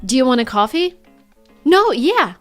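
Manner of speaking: usual speaking voice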